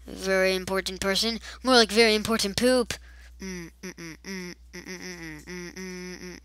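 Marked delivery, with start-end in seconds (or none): none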